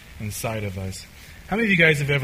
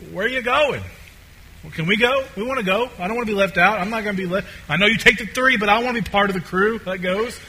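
higher-pitched, eager pleading questions; clueless disciples; 5 semitones higher, faster than usual. Acted. Disciples hearing Jesus is leaving, clueless and pleading. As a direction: play followers anxious not to be left out. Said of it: "clueless, they're like".

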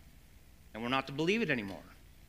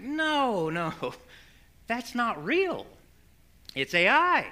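much higher voice, drawn-out "no"; imitated exasperated insistence; 9 semitones higher, 5 dB louder, slower than usual. Daughter correcting her fooled parents, mock exasperated insistence. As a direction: act out correcting someone who won't get it.

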